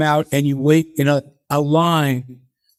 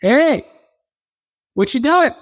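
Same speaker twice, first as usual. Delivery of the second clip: mock night watchman calling out; 11 semitones higher than usual. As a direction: call out across a dark loading dock. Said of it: "you hear a voice from over the camera go".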